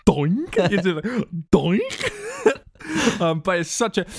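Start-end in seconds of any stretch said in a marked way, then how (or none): 0.1-2.6 silly doink sound. Pretend. Imitating sledgehammer sound.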